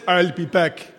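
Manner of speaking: put-on action-hero catchphrase voice; Arnie. Preacher quoting a film catchphrase, Arnie impression. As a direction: drop into the film star's accent. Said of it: "where Arnie says".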